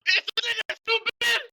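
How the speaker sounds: high-pitched, drawn-out cry of exasperation; exasperated outburst. Real, not acted. Debater erupts at opponent's answer, exasperated outburst. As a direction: burst out with it, pitch leaping way up.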